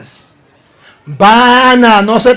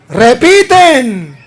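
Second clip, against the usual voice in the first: ordinary delivery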